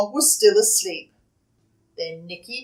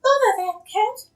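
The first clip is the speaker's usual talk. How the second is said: exasperated mum; 13 semitones higher, 3 dB louder than usual. Acted. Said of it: "Missus Thomas said".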